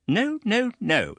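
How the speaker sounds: put-on high, quick stern old lady; brisk denial. A narrator's reading. Aunt briskly dismissing the widow's fears, high. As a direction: wave the worry away. Miss Betsy hushing.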